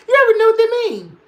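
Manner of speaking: high, loud, fast laughing squeal; squealing laugh repeat. Real, not acted. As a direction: shriek it through laughter.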